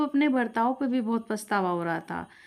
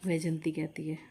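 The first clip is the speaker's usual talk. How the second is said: usual speaking voice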